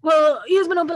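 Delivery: eager excitement. Retold or imitated. To the woman on the train, eager and excited. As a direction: push the friendship eagerly.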